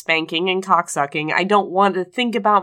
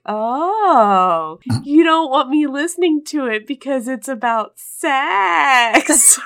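high, teasing imitation of a giggly mom; 6 semitones higher, 3 dB louder, much slower than usual. Host imitating her mom learning the topic, giggly. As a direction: draw it out slowly, tickled and knowing. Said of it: "like, very giggly and she's like".

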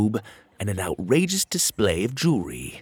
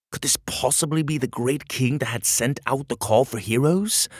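ordinary delivery

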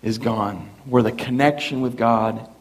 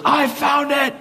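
excited shout; 12 semitones higher, slower than usual. Retold or imitated. Dad shouting from garage.

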